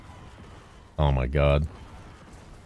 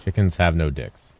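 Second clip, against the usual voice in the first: ordinary talk, nothing marked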